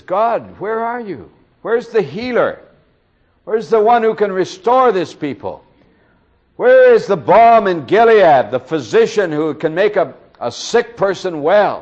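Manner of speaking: high, loud desperate plea to God; anguished cry. Retold or imitated. Preacher voicing Jeremiah crying out to God: high, loud anguish. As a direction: cry out to heaven for a healer. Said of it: "he cries out and says".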